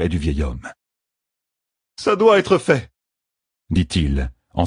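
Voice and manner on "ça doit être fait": higher, tense determination; insist, steeling yourself; resolute Matt. A narrator's reading. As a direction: Matt tells his friends to stay back. Matt steps away from friends.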